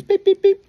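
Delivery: high, loud mimicked dial tones; phone beeps. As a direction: beep like a phone keypad, way up high. Imitating phone dialing beeps.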